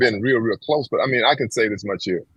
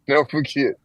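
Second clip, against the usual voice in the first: ordinary delivery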